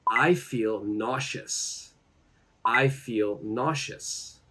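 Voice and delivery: slow, careful teaching delivery; slow model phrase. Shown as a demonstration. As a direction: say it slowly for learners to repeat.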